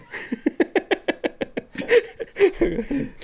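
high breathy laugh; burst of laughter. Real, not acted. Laughs at РЖД joke.

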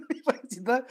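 laughing through the word, high. Real, not acted. Teacher laughing at her own mantra sketch, laughing. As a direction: chuckle as you say it.

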